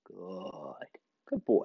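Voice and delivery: hushed praise for the dog; quiet praise; soft praise. Real, not acted. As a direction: murmur it to the dog.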